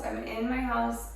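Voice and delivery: ordinary delivery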